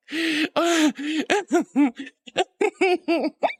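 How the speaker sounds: long, high giggling fit; can't stop laughing; high peals of laughter. Real, not acted.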